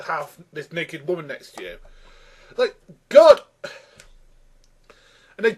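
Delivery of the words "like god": loud, high exasperated outburst. Real, not acted. Reviewer appalled by the sketch, exasperated. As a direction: burst out, appalled.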